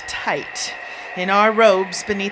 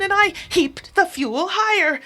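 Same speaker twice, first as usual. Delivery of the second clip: usual speaking voice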